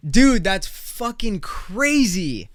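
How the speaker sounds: high, loud, drawn-out astonishment; real disbelief. Real, not acted. Streamer reacts to shooting story, stunned disbelief.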